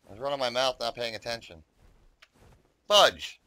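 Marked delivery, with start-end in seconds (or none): 2.9-3.4 loud exasperated shout; frustrated outburst. Real, not acted. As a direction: blurt it out in frustration. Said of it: "And he didn't say fudge".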